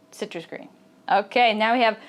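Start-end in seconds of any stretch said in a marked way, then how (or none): 0.1-0.7 whispered Citrus green. Shown as a demonstration. Teacher whispering the phrase for learners. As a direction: breathe it out with no voice. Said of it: "whispered repeat".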